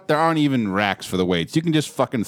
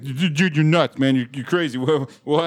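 nervous laugh-off; 5 semitones higher than usual. Retold or imitated. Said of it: "trying to laugh it off".

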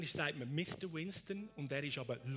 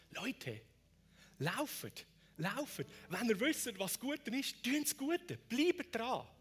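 raised, higher rallying call; urgent exhortation; 8 semitones higher, 6 dB louder, slower than usual. Acted. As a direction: urge the crowd on loudly. Jesus' urging voiced by preacher.

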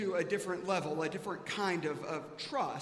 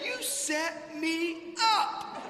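slow, sing-song, higher, louder; drawn-out accusation; 10 semitones higher, 4 dB louder, much slower than usual. Retold or imitated. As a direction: drag it out teasingly. Dad's comic accusation.

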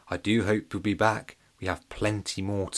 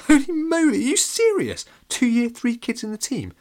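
much higher, louder shocked cry; a kid stunned by surprising news; astonished; 14 semitones higher, 7 dB louder than usual. A narrator's reading. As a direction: gasp it out in disbelief. Jenny amazed at news.

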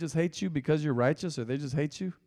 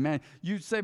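ordinary talk, nothing marked

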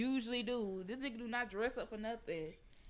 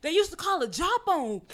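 loud, high, hammed-up comedian impression; Bernie Mac; 8 semitones higher, 11 dB louder than usual. Quoting the House Party Three scene, loud comic impression. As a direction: belt out the movie line, pitched up. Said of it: "And Bernie Mac was like".

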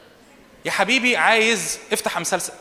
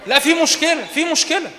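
high, loud insistent warning; raised warning; 10 semitones higher, 5 dB louder than usual. Real, not acted. Preacher insists to the youth that there is a problem, loud and high. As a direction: hammer the problem home, louder.

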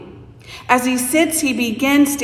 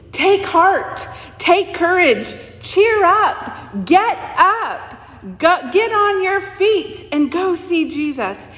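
higher, eager encouragement; excited crowd; 6 semitones higher than usual. Acted. Crowd cheers the blind man toward Jesus, excited. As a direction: urge him up with glee. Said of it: "The excitement is palpable in their voices".

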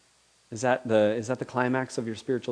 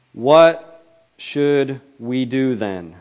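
slow pleading question; 2 semitones higher, 9 dB louder, much slower than usual. Acted. Crowd's question voiced in scripture.

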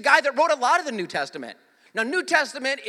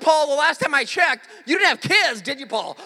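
louder, laughing jab at Paul; laughing mock challenge; 5 semitones higher, 7 dB louder than usual. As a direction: tease Paul through a laugh.

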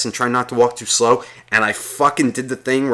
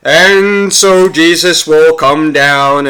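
loud, drawn-out priest's intoning; mock church sermon chant; preaching priest; 5 semitones higher, 7 dB louder, much slower than usual. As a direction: intone it slowly to the congregation, higher and louder. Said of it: "He's talking to himself in the church. He's just like".

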